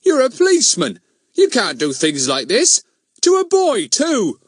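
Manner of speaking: high, loud, scandalised protest; shocked, pitched right up. A narrator's reading. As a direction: protest in outraged disbelief. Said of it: "in a shocked voice".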